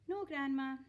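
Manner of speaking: put-on little boy, meek and dutiful; small boy. A narrator's reading. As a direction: answer like an obedient child. George voiced in read story.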